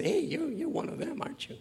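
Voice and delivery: mock little girl asking, high and light. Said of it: "little girl came and said".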